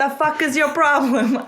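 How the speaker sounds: mock-aggressive Boston accent like a Dunkin' Donuts sketch. Podcast host goading her co-host over a movie, hammed-up Boston accent. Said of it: "I should do the Boston thing and be like".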